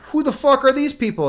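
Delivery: high-pitched appalled demand; horrified disbelief. Acted. Prince meeting the three cousins, horrified.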